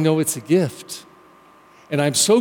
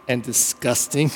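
normal talking voice

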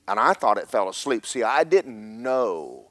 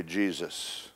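ordinary talk

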